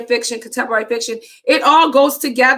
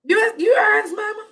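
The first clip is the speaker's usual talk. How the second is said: much higher, yelled disbelief; shocked shout; 10 semitones higher than usual. Real, not acted. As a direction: shriek it in playful shock. Mock shock at the reveal.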